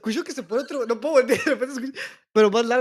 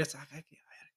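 whispering; 4 semitones lower, 17 dB quieter than usual. Real, not acted. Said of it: "¿Por qué hablas así".

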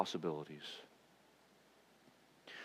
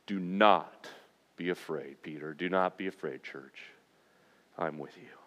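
much lower and far softer; tender reassurance; 6 semitones lower, 5 dB quieter than usual. Acted. Jesus soothing a frightened Peter and church: low, soft comfort. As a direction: hush his fear gently, almost a murmur.

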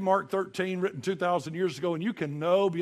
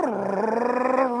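loud, high rolled brrr; imitated quake roar; 8 semitones higher, 8 dB louder than usual. Preacher showing the quake hitting the house, loud rumbling brrr. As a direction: trill it hard like a train tearing through. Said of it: "you'd sound like a train".

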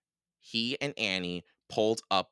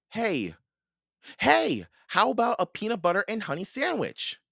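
put-on high-pitched, eager child's suggestion; sudden bright idea; 13 semitones higher than usual. A narrator's reading. Boy has idea to offer warrior.

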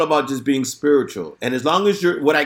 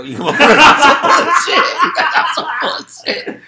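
laughing while quoting; 13 semitones higher, 7 dB louder, slower than usual. Retold or imitated. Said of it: "'cause I was like".

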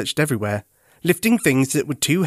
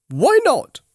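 high, louder curious monkey; Monkey's voice; 13 semitones higher, 4 dB louder than usual. A narrator's reading. Monkey asking Teddy what is wrong, high and curious. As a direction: pipe up like a friendly monkey. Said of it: "said a voice nearby".

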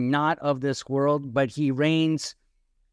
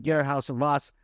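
ordinary talk

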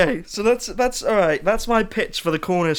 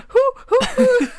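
high, loud owl hoots; owl hooting; 4 dB louder than usual. Shown as a demonstration. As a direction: hoot like an owl. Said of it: "Recreate the sound of an owl".

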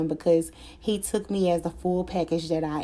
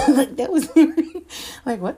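laughing; 7 semitones higher, 6 dB louder than usual. Real, not acted. Laughing about his aggressive ask.

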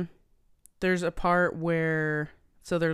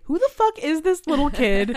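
high, loud indignant yell; 11 semitones higher, 9 dB louder than usual. Retold or imitated. Viewer ranting at the kid in the kissing booth, loud and high. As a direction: call out the kid in disbelief. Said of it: "I was like".

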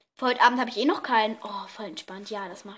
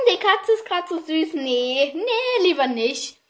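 higher, louder cooing refusal, imitating herself; distracted self-quote; 4 semitones higher, 5 dB louder, slower than usual. Acting out her own excuse about the cute cat, distracted. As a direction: act out getting sidetracked, drawing out the last refusal. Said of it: "Aber dann war ich so".

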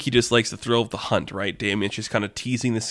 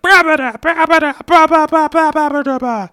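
very high, loud gibberish screams; frantic squealed babble, piercingly high; screaming minion; 9 dB louder than usual. Acted. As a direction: shriek nonsense syllables like a panicked minion. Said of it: "you just hear Hopper screaming at the back".

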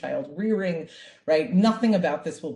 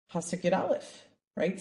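ordinary delivery

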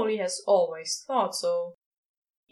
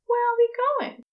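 higher, louder angel voice; Aziraphale's voice; 5 dB louder, faster than usual. A narrator's reading. Aziraphale asking Crowley, in a high eager voice. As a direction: lift into the angel's voice.

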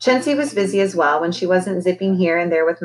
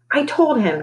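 squawked; 7 semitones higher than usual. A narrator's reading. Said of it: "Chincy squawked".

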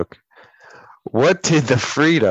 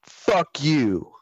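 higher-pitched mock insult as a punchline; joke punchline; 5 semitones higher than usual. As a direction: deliver the insult as the big payoff. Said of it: "it's all in the delivery".

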